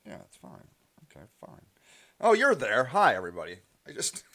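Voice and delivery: ordinary talk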